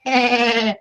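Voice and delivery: high-pitched cartoon laugh; Peter Griffin laugh. Shown as a demonstration. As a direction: do a silly cartoon chuckle. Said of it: "Pe-, Peter Griffin laugh".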